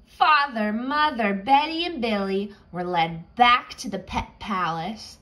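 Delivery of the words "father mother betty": ordinary delivery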